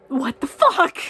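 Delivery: a sudden loud stunned swear; shocked outburst. Real, not acted. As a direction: blurt it out in real shock. Shocked by book twist.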